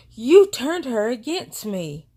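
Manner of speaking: retold angry accusation, hit slowly and hard; mad sister. Sister accusing her of stealing the baby's favor, mock angry. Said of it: "my sister was mad".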